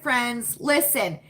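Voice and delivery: ordinary delivery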